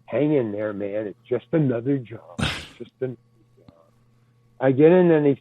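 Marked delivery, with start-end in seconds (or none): 0.1-3.7 low, near-whispered self-reassurance; self-talk pep. Retold or imitated. Actor calming himself before firing, low and soft. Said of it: "So I'm saying".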